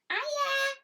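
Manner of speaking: high, sweet little hello; a spider waving hello; friendly spider. Acted. Spider drawing greets viewers.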